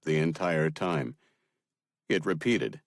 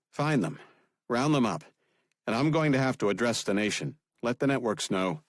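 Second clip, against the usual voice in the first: a lightly raised, higher commanding voice; President giving orders; 6 semitones higher than usual. Acted. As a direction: give firm orders to staff.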